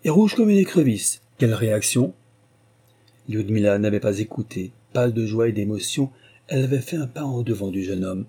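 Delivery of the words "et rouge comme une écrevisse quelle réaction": excited. A narrator's reading. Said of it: "s'écria-t-il".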